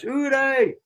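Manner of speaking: high-pitched Scouse exclamation; badly imitated Scouse advert voice; attempted Scouse accent. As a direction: squeal it in mock Scouse. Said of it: "I can't do the Scouse accent".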